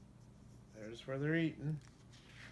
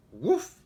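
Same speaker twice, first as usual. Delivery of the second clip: loud, high dog woof; playful read-aloud woof; woof bark; 6 semitones higher, 9 dB louder than usual. Acted. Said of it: "said Dog".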